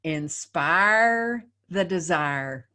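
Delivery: slow, drawn-out Southern accent. Shown as a demonstration. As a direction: lean into a hammy Southern drawl. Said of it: "in the worst Southern accent you can drum up".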